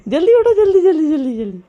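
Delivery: loud, high, repeated urging; urgent wake-up call. Real, not acted. Mother waking her sleeping child, urgent shouting.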